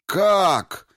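громкий высокий вскрик; невольно вырвавшийся изумлённый возглас; изумлённо. A narrator's reading. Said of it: "вырвалось сразу у всех". (изумлённый вскрик)

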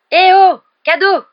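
loud excited wake-up call; cri joyeux. Acted. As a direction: shout it out, high and loud, waking a friend. Ron réveille Harry en criant. (réveil crié, aigu et fort)

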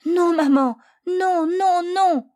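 high, loud desperate no; desperate crying out. Acted. Boy crying desperately to his mother, high and loud. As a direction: cry out in despair, clinging to mum. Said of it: "en criant désespérément".

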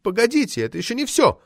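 high, worked-up protest; excited high-pitched interruption; getting carried away. A narrator's reading. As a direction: burst in, refusing to stop. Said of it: "разошлась Метелина".

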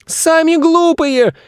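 very high, drawn-out squeal; boy shouting. A narrator's reading. Boy answering zoo visitors' insults, shouting. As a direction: throw it back slowly in a shrieky child voice. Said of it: "крикнул Федя".

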